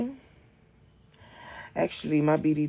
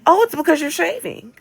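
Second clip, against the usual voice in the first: mock know-it-all; 7 dB louder than usual. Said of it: "And they say".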